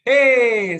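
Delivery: loud high cheer; joyful cheer for the group; cheering hey. Shown as a demonstration. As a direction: shout hey like an excited child.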